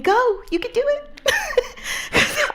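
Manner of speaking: very high, slow, laughing cheer; mock cheerleading. Woman cheering the digging helper, high and slow. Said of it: "I was more there just cheerleading".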